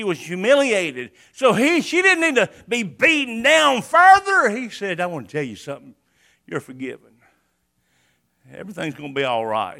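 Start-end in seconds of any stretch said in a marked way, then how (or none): 5.0-9.8 much softer and lower, gently consoling; tender reassurance. Retold or imitated. Jesus forgiving the humiliated woman, soft and tender. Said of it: "He spoke tenderly".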